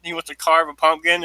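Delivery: ordinary talk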